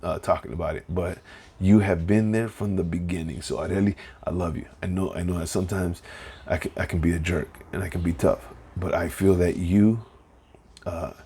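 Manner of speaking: low, soft and choked up, speaking to his wife; holding back tears while thanking her; getting emotional. Real, not acted. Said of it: "I'm getting emotional".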